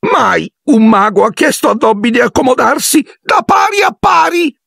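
extremely high shrieking elf; sobbing house-elf outcry. A narrator's reading. House-elf overwhelmed by a wizard's courtesy, shrieking. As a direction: burst out in tearful awe.

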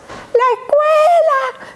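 put-on high, slow little girl voice; drawn-out child answering her mother; child's answer. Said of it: "daughter answers question".

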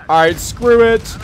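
put-on high, loud old man voice. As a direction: snap it like a cranky old man. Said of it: "I'll still play like Old Man Blitz".

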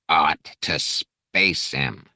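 very slow, each word ground out of the throat; grinding, laborious. Acted. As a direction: drag every word up from the larynx. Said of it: "each word grinding laboriously from his larynx".

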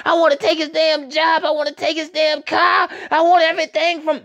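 high, far-off voice of a covetous schemer; greedy lurker voice. Retold or imitated. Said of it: "They be lurking all up in the shadows with".

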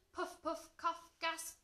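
high, staccato sound words; breathless alarm. A narrator's reading. Narrator on dragon choking in smoke, rising alarm. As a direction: build alarm word by word.

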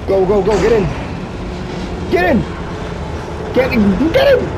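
high yelled commands in a panic; frantic shouting. Real, not acted. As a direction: scream at them to get in. Chase in game, calling companion.

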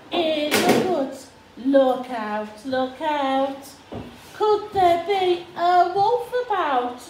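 put-on reading to a toddler. Dramatic refrain in picture book.

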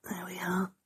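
hushed whisper so the dog sleeps on. Real, not acted. Whispering by the sleeping dog's bed, soft whisper. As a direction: breathe the words, barely voiced. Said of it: "dog asleep, not waking her".

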